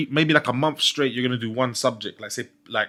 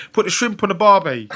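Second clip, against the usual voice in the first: higher mock Australian catchphrase; Australian accent; 6 semitones higher, 4 dB louder than usual. Shown as a demonstration. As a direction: attempt a cartoonish Australian. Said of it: "Can you do an Australian accent?".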